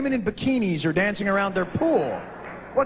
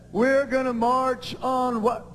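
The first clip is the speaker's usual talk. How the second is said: spaced-out stoner; 7 semitones higher than usual. Retold or imitated. Stoner guy at pot demonstration.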